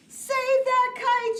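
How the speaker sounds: high, loud, slow cry; urgent shout. Acted. Mole shouting to save the falling kite, high and loud. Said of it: "shouted Mole".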